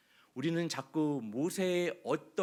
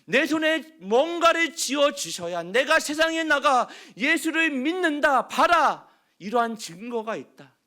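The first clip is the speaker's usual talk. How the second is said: loud, high demanding protest; complaining to God; 9 semitones higher, 9 dB louder than usual. Acted. Churchgoers demanding a sign from God, loud complaint. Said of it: "원망도 해보죠".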